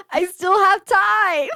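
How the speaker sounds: high, drawn-out [name] catchphrase; slow, squealed mock [name]; [name] impression. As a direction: stretch it out in a high put-on [name] voice. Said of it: "I should have looked up [name] phrases".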